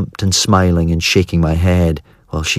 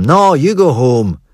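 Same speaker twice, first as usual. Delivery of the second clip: brother's retort; 7 semitones higher, slower than usual. A narrator's reading. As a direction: throw it back at her, slowly. Brother throws it back.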